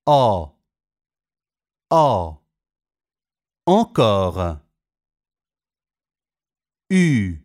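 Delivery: each vowel said alone, then in a clearly spoken French example word; French vowel demos. Shown as a demonstration. Teacher reading French vowel examples to Italian students, crisp and clear. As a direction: model every sound crisply for students to repeat.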